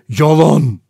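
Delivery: loud, muffled, choked outburst. A narrator's reading. Rogers denying the accusation, in a muffled voice. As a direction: force it out in a choked voice. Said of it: "Rogers boğuk bir sesle".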